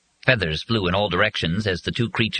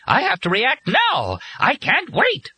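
very high-pitched, urgent screech; frantic fury; 3 dB louder, slower than usual. A narrator's reading. Bird driven to react at once, frantic. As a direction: cry out as the enraged bird. Enraged bird about to attack.

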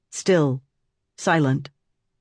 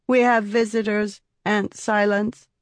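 put-on high, cold child monotone; flat dead-eyed child voice; 7 semitones higher than usual. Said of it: "in a cold, monotone voice".

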